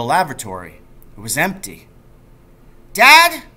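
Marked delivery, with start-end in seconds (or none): 2.9-3.4 full loud high shout. A narrator's reading. Boy shouts for his missing father into the darkness, full loud. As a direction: yell into the darkness. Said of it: "I shouted into the darkness".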